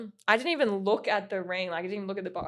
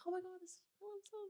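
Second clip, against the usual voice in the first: tiny, high hushed voice; very soft, high-pitched retold gasp of realisation; hushed awe; 10 semitones higher, 21 dB quieter than usual. Telling friend how she reacted to their song, hushed awe.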